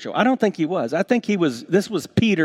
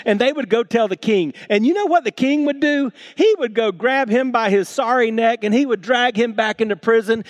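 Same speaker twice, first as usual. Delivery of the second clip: higher, louder full-force preaching; 8 semitones higher, 4 dB louder than usual. Acted. Preacher building to the king's anger for his church, full force. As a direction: drive the story home from the pulpit.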